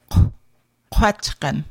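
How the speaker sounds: "kwatchkan" with lips rounded on the back k. Shown as a demonstration. Teacher modelling rounded Q for learners, rounded lips. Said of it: "Q with rounded lips".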